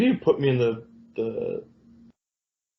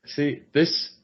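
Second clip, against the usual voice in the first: ordinary talk, nothing marked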